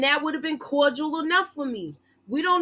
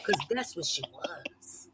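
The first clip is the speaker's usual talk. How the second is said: under her breath; whispered aside; 9 semitones lower, 11 dB quieter, slower than usual. Real, not acted. Host tacking on a catty comment, whispered aside. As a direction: drop to a whisper, slow.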